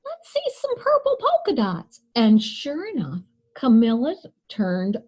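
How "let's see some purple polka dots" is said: high, louder taunting call; kid yelling out. Acted. Classmate calls out to Camilla, high teasing yell. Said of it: "One yelled out".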